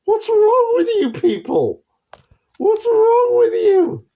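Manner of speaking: very high, loud, strained disbelieving screech; incredulous squeal. Retold or imitated. The world shrieking at the UK in very high, loud disbelief. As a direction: shriek it like the whole world is appalled. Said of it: "they start to go".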